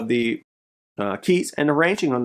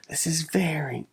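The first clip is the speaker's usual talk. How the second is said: whisper; 3 semitones higher, 7 dB quieter than usual. Real, not acted. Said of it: "whispering over the find".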